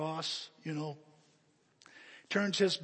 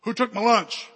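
loud, higher-pitched challenge; loud demand; 6 semitones higher, 13 dB louder than usual. Acted. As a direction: demand the culprit as the big kid. Big kid demands the culprit.

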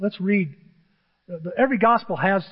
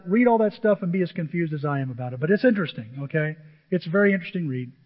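normal talking voice

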